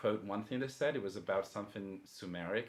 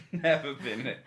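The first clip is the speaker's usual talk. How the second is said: laughing echo; 6 semitones higher, 10 dB louder than usual. Real, not acted. Amused echo of friend.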